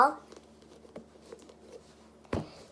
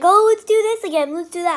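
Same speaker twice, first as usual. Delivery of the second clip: higher, louder breezy doctor impression; mocking the doctor; 5 semitones higher, 7 dB louder than usual. Mocking the doctor's offhand plan to retest, higher and louder. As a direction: toss it off like a careless doctor. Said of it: "The doctor's just like".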